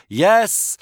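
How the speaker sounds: loud, high shout at the door; Henry yells to stop the knocking. A narrator's reading. Henry shouting at the knocker through her door. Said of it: "shouted Henry".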